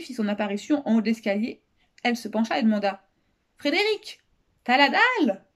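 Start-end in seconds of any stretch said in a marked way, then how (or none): 3.6-5.5 put-on high girl's voice calling from upstairs; high-pitched kid shouting a question down. A narrator's reading. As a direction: call out high, tossing the question down. Girl calls down from stairs.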